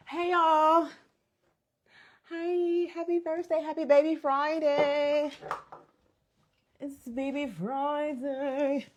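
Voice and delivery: high, slow drawn-out hello; sing-song greeting. Real, not acted. Greeting live viewers, drawn out.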